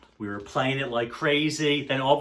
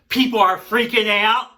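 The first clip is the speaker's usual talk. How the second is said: loud, high burst of excitement; excited outburst; 8 semitones higher, 9 dB louder than usual. Retold or imitated. Telling viewers the band came out, excited outburst. Retelling crowd's frenzy as band appears.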